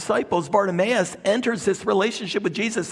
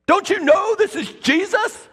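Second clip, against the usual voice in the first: indignant crowd; 10 semitones higher than usual. Retold or imitated. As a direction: rebuke him as a disciple. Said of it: "They're looking at him like".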